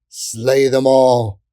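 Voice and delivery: loud, very slow, drawn-out order; menacing command. A narrator's reading. Leader ordering the fairies slain, slow loud command. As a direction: draw out the kill order.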